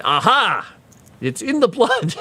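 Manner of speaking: high, gleeful mock triumph; triumphant aha. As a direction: crow it like a case proven. Acts out shopkeeper's triumphant reply.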